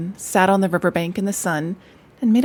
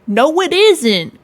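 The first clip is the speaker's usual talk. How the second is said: higher, louder, slow indignant protest; indignant cry; 7 semitones higher, 8 dB louder, slower than usual. Acted. Rat contradicting Mole, loud indignant retort. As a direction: fire it back indignantly. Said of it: "cried the rat indignantly".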